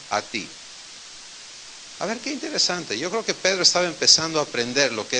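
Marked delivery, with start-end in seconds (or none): none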